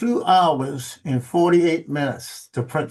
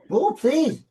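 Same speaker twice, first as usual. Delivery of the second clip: higher, impatient repeat of the request; insistent repeat; 10 semitones higher than usual. Real, not acted. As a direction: push harder, losing patience with the dog. Dog still not moving.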